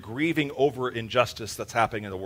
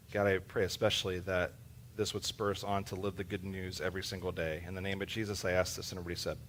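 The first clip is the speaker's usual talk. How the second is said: quiet prayer; 4 semitones lower, 9 dB quieter than usual. Real, not acted. Leads prayer.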